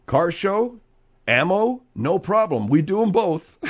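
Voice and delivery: upbeat spoof announcer read; mock ad pitch. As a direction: pitch it like a local advert, breaking into a laugh. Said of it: "what they should say there in Medford".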